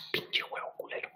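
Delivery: breathy whispered curse at the game. Real, not acted. Cursing the game in a whisper. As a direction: hiss it under your breath.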